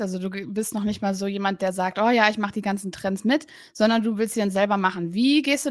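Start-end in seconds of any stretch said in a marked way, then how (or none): none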